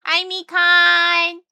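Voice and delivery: high, slow sing-song hello to a fan; cutesy high greeting. Acted. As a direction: coo the name sweetly.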